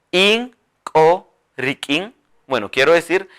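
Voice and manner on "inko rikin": Inko rikin drawn out; slow dictation. Shown as a demonstration. Teacher models the phrase for the class. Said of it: "Todos juntos".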